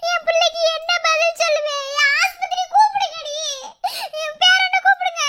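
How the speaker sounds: high, tearful pleading; distraught wailing. Acted. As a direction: wail for someone to fetch help. Wails for help over collapsed girl. (அழுது புலம்பியபடி)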